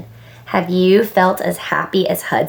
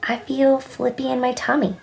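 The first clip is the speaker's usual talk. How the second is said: put-on soft, slightly higher child's confession; said softly; 4 semitones higher, 6 dB quieter than usual. A narrator's reading. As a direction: softly admit a queasy tummy. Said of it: "Sam said softly".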